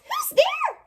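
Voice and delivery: very high startled squeak; a tiny mouse calling out into the dark; high mouse squeal; frightened mouse cry. A narrator's reading. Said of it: "Mouse cries".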